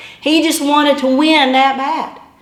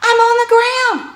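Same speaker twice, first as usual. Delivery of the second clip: high, loud desperate yell; wife yelling up at her husband; 11 semitones higher, 4 dB louder than usual. Retold or imitated. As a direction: cry out from the ground to make him stop. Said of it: "I yelled up at him".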